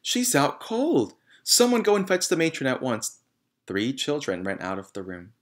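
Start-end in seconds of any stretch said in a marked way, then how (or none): 0.0-3.2 high, louder urgent call for help; urgent cry. A narrator's reading. Miss Honey crying for someone to fetch the matron. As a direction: cry out in alarm. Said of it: "she cried".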